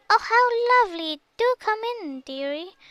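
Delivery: the wolf croaks out a sweet welcome; croaking. A narrator's reading. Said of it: "croaked the wolf".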